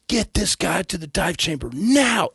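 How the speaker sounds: a senior doctor orders the staff to move now; commanding doctor. Retold or imitated.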